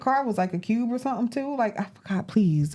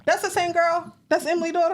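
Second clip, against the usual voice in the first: high-pitched, incredulous questions; genuinely shocked, voice shooting up; 9 semitones higher than usual. Real, not acted. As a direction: ask it in real disbelief, pitch jumping up. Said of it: "I'm shocked".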